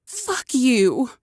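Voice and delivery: playful curse. A narrator's reading. Cherry swears at Dante over the rolls, high and playful. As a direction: fire it off playfully. He barked out a laugh.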